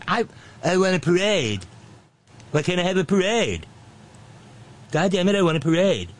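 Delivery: high mock tantrum; Nitwit Nero. Boss demanding a parade, hammed-up high whine. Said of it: "he wanted a parade".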